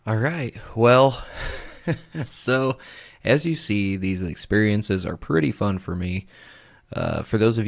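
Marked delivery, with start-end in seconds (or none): 1.3-2.2 short breath of laughter; amused chuckle. Real, not acted. Host wrapping up the ghost clips, amused chuckle. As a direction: chuckle softly between words.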